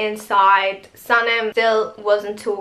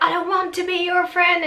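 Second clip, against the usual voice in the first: high-pitched mock angry refusal; retold anger; 7 semitones higher, faster than usual. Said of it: "she gets so angry".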